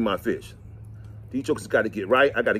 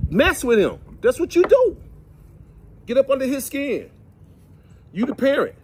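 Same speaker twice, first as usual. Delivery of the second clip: emphatic preaching; 11 semitones higher, 4 dB louder than usual. Real, not acted. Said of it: "preaching to parents".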